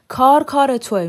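husband yells the blame at her; shouted accusation. A narrator's reading. Husband shouting accusation at his wife. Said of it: "داد زد".

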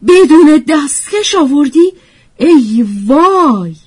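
high, loud sudden outcry of alarm; startled high-pitched exclamation; screech-like cry. A narrator's reading. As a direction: shriek it in sudden horror, drawing out the final wail. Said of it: "ناگهان با صدای جیغ مانندی گفت".

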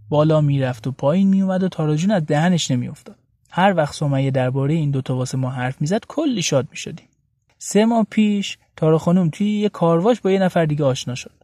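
ordinary talk, nothing marked

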